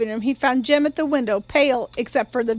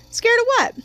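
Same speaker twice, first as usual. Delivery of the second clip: put-on high, sharp child's question; little sister startled by her brother's fear; 11 semitones higher than usual. A narrator's reading. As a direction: jump up in pitch, alarmed. Little sister asks, alarmed.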